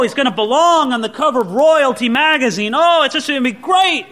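excited crowd. Retold or imitated. Preacher voices the excited crowd.

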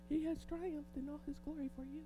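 soft, quick and subdued, a hushed aside; said quietly. Shown as a demonstration. Preacher repeating the line quietly for the congregation, hushed. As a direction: tone it right down to sound sane. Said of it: "say that quietly".

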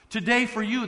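ordinary delivery

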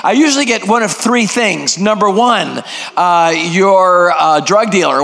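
ordinary talk, nothing marked

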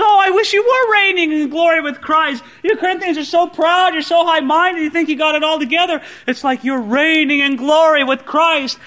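high, loud mocking wish; sarcastic. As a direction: needle proud listeners with mock envy. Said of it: "Paul is using some strong sarcasm".

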